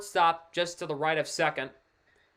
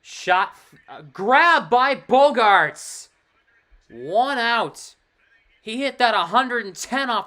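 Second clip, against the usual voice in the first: excited call; 7 semitones higher, 11 dB louder, much slower than usual. Real, not acted. Calling a great catch live.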